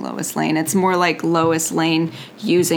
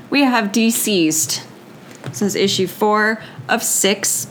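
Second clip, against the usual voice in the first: ordinary delivery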